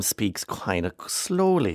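drawn-out, slow delivery; slowed-down speech. Retold or imitated. Host mimics the politician's slow speech, drawn out. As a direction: stretch the words out slowly. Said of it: "he's doing a very slow down".

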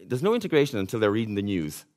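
ordinary talk

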